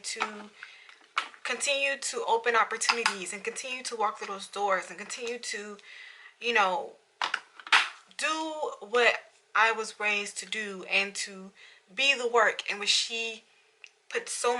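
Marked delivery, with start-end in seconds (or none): none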